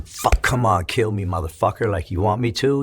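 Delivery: desperate outcry to the cold sky; defiant yell. Retold or imitated. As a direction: shout it up at the sky, daring it. Retold yelling at death, freezing.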